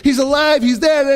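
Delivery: high, rushed report; acted-out excited witness; breathless excitement. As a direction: blurt the news urgently. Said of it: "these two, they're out of breath".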